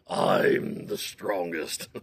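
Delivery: slow, low bear boast. Acted. Bear boasting to the forest, slow and low. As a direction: draw it out smugly.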